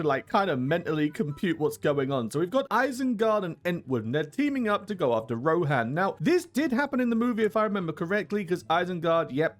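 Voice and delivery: usual speaking voice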